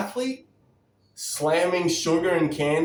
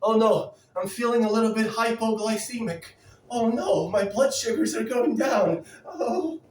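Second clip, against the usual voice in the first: higher, whiny mock panic; mock panicky dieter; 5 semitones higher than usual. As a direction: ham up a dieter panicking over blood sugar. Mocking hypoglycemic dieter.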